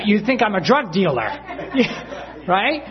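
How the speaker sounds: ordinary talk, nothing marked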